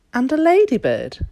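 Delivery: plain reading voice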